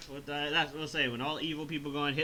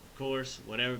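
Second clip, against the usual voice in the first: ordinary talk, nothing marked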